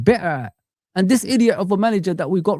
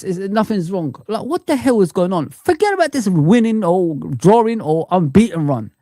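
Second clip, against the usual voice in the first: ordinary delivery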